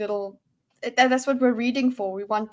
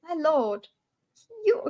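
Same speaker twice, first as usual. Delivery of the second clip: lightly attempted Valley accent, higher; tentative Valley accent; 5 semitones higher, slower than usual. Player trying Lady Valette's accent, tentative Valley. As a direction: try a hint of Valley accent. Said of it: "I'm gonna try Valley".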